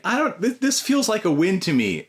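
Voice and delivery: squeaky with disbelief at a small win; amused disbelief. Retold or imitated. Dad reacting to his pantless toddler's manners, amused and high.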